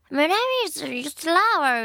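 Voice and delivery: slurred, rolled mock-native English accent; mock fluent English accent. Host shows how English could sound with serious study, mock fluent English accent. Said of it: "真剣にやればもっとなんか".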